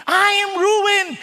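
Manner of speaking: high, loud, drawn-out lament; slow, dramatic wail; awestruck cry of woe. Acted. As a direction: cry out as a ruined prophet. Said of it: "nagkaroon siya ng sense of".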